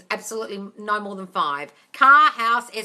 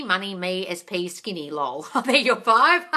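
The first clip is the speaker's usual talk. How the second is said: usual speaking voice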